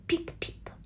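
tiny whispered peeps; barely voiced newborn chick sounds. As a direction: breathe out little chick cheeps. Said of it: "si sentì".

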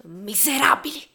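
furious high scream. Acted. Narrator screaming at the policemen in fury. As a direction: hurl it at them with full force.

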